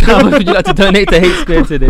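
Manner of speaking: loud, high, laughing through the words. Real, not acted. Host echoes a donation joke to the caller, laughing loudly. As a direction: crack up while repeating the joke.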